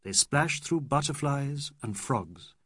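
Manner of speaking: ordinary delivery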